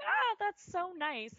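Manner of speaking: high, slow, touched coo; drawn-out sweet high sigh; mock touched sigh. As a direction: coo it like you're moved. Imitating touched rider.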